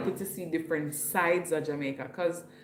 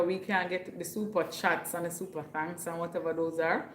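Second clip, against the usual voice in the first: normal talking voice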